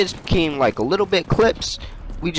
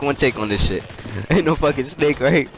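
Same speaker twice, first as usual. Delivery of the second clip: usual speaking voice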